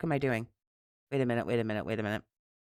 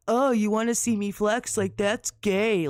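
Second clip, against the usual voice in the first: mocking bro voice; 4 semitones higher than usual. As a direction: parody a dumb macho guy. Mocking the streamer's past words.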